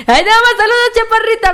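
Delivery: calling out a fan with a lift; excited shoutout. Real, not acted. (saludo emocionado y agudo)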